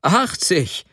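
high exclamation, mild amusement; laughing outcry. A narrator's reading. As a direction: cry it out in amused disbelief. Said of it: "rief Sobotka lachend".

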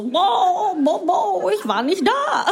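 high, mumbling mock Scholz through a laugh; limp boiled-fish Scholz. Comic mocking German chancellor as listless cooked fish, high and laughing. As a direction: play him as a lightly voiced limp cooked fish. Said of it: "Energie von so einem gekochten Fisch".

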